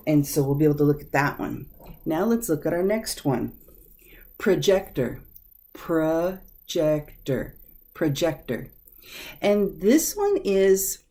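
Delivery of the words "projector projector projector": teacher sounding out "projector" syllable by syllable; careful "projector", stressed on "jec", stretched in the middle take; pronunciation model. Shown as a demonstration. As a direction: say "projector" whole, then slowly in syllables pruh-JEC-ter, then whole. Teacher models the new word.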